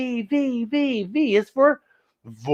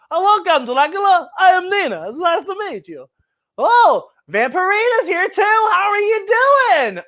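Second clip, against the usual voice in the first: excited greeting; 10 semitones higher than usual. Pretend. Nina greets vampires, acted.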